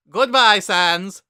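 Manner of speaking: high, loud, booming skeleton brother; loud high cartoon brother voice; Papyrus voice. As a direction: bellow it out like an eager kid brother.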